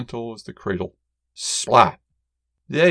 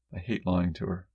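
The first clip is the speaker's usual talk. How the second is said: usual speaking voice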